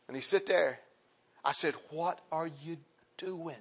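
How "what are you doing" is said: soft, slow, deliberate question; hushed, measured pastoral probe; quiet confrontation. Retold or imitated. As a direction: drop right down and ask it slowly. Same question repeated quietly.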